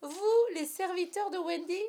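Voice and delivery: high, loud, incredulous outburst; aghast big-brother squawk; outraged disbelief. A narrator's reading. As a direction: blurt it out, utterly scandalised. Brother scandalised at serving a girl.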